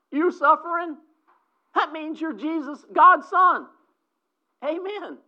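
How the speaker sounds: fervent build. Real, not acted. Preacher rises in fervor.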